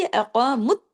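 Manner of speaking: अक़ामुत तौरा chanted in tarteel, with a full heavy क़ा; Quranic recitation. Shown as a demonstration. Teacher reciting a verse for her students, melodic recitation. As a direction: recite it like tajweed class. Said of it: "अब देखिए".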